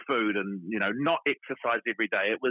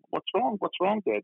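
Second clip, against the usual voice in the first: put-on a worried little girl's voice, pitched up; higher child voice, worried; imitating young daughter; 9 semitones higher than usual. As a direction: lightly play the anxious child asking Dad. Daughter quoted asking about tears.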